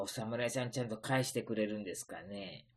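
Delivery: normal talking voice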